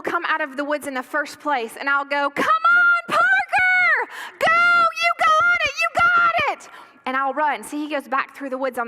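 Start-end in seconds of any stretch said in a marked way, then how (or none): 2.3-6.7 high, full-voiced sideline yell; yelling parent. Parent cheering on a running son, loud high yelling. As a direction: cheer as the loudest, most embarrassing parent. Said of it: "yelling like the loudest, most embarrassing parent".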